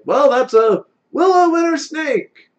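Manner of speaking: high, loud, slow cheering; excited cheer. Acted. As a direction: cheer like an adoring fan. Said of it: "So he's like".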